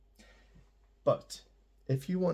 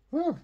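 whew; 6 dB louder than usual. Real, not acted. Relief after explaining section.

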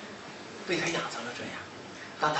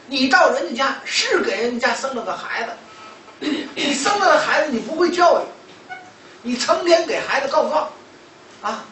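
loud, high-pitched telling-off; retold rebuke, pushed hard; scolding; 7 semitones higher, 6 dB louder than usual. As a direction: reproach a mother point by point, voice raised.

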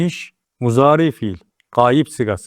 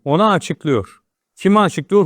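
ordinary talk

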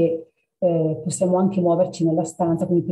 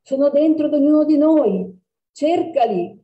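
higher, louder call as he flees; shouting; 8 semitones higher, 5 dB louder than usual. Acted. Cat shouts to the girl as it runs away. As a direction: call it out while escaping. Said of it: "gridò".

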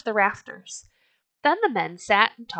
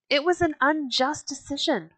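crying out; 8 semitones higher than usual. A narrator's reading. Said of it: "someone cried".

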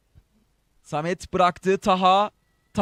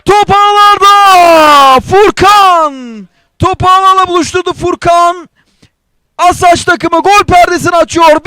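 screaming football commentator; 4 semitones higher, 4 dB louder than usual. Real, not acted. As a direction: roar the goal to the stands. Goal scored, commentator erupts.

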